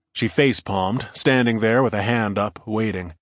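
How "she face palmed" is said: usual speaking voice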